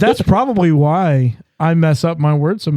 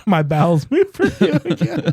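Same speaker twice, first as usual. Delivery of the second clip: laughing; 6 semitones higher than usual. Real, not acted. Cracking up at a silly joke.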